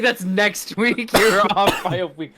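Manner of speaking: half laughing, incredulous; exasperated laugh. Retold or imitated. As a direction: correct him in disbelief, laughing. Exasperated correction, retold.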